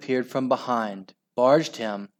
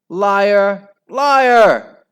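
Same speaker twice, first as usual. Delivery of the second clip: high, loud yell, building; screamed accusation; 9 semitones higher, 10 dB louder than usual. A narrator's reading. Children accusing Al, screaming. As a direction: scream it, higher the second time.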